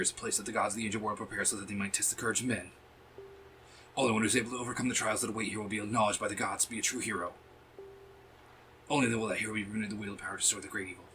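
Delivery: fast, even reading of game dialogue; brisk read-through, little acting; rushed monologue read-aloud. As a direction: rattle through the long speech. Reads game character's monologue aloud.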